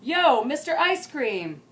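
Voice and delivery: loud, slow drawn-out call; girl hollering for the ice cream truck, loud and slow; calling the ice cream man. Acted. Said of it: "Lucy most loved to scream".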